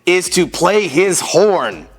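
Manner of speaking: animated, theatrical picture-book reading; children's story narration. A narrator's reading. Storyteller reading a parade book to children, animated narration. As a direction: read it out with exaggerated swings of pitch.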